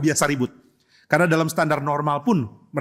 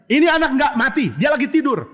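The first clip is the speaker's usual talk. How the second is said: high, raised-voice announcement; forceful, not gentle; 8 semitones higher, 4 dB louder than usual. Acted. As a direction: call it out over a noisy crowd. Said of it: "Nggak mungkin Tuhan Yesus ngomongnya baik-baik". (berseru lantang, nada tinggi)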